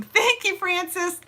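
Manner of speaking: gushing thanks. Real, not acted. Thanking a viewer.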